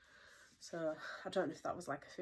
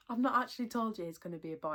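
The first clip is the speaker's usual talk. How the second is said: exasperated; 5 semitones higher, 6 dB louder, faster than usual. Real, not acted. Exasperated at Jace.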